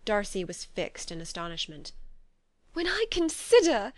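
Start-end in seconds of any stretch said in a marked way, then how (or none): 2.7-3.9 much higher and louder, agitated; more agitated. A narrator's reading. Elizabeth blaming herself to Darcy, highly agitated. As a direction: let the agitation break through. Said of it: "in a yet more agitated voice".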